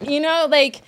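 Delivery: normal talking voice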